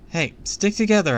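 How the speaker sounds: high, loud call to the group; shouting. A narrator's reading. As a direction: call out to keep everyone close. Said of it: "I shouted".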